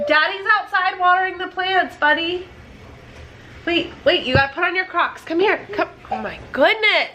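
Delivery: high-pitched parent voice. Real, not acted. Mom sending her little boy to Dad, child-directed. Mother talking to her young son.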